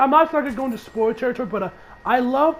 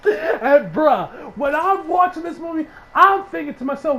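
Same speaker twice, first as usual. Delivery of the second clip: ordinary talk, nothing marked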